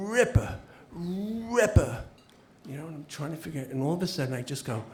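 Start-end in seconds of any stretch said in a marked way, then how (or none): 0.1-2.0 high, strained, mock-struggling "Ripper"; imitating a stroke patient. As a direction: imitate a man fighting to get one word out. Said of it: "he's, he's going".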